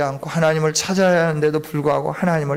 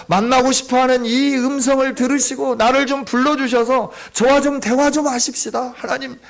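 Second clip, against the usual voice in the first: fervent prayer; 4 semitones higher than usual. Acted. Preacher acting out a prayer for the congregation, raised fervent pleading. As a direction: cry out to God, begging for an answer.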